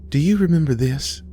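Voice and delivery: the dreamlike mama, lightly reminiscing; mother in a vision. Acted. Mama shows mint to fading Aisha, lilting.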